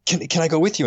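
a lightly voiced child's plea; hint of a little boy begging to come; small son asking. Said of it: "one day he said".

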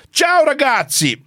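high, bright-pitched mock Italian greeting; hammed-up Italian accent; Italian accent impression. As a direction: greet the boys like an Italian team boss. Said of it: "I can't do Italian, but I'll do my best".

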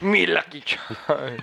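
slow, drawn-out Irish lilt on "Me Lucky Charms"; imitating the accent. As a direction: echo the stage Irish accent back, teasing. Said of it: "That accent".

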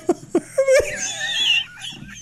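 shrieking laugh at the joke; high shrieking laugh. Real, not acted. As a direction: let a high laugh burst out.

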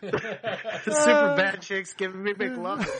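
slow, laughing it out. Real, not acted. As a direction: talk through laughter. Laughing remark.

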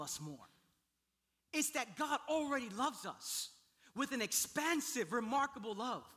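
high, loud, impassioned sermon delivery; full-force preaching. Real, not acted. Pastor proclaiming grace to congregation, full-force preaching. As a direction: proclaim it with urgent conviction.

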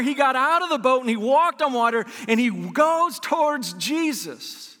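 ordinary delivery

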